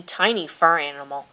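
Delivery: plain reading voice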